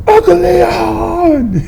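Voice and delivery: high, stretched-out slow wail of a name; desperate cry. Retold or imitated. Winded boy crying out for his uncle, slow and high. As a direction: call out for help, dragged out and high.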